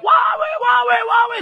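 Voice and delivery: high mock cry of wawe, wawe; mock worship shout. Pastor mocking noisy worship, high mock cry. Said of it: "yang teriak-teriak".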